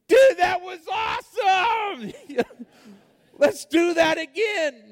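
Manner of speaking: excited brother cheering the crash; thrilled shout. Retold or imitated. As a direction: yell with glee at the wreck.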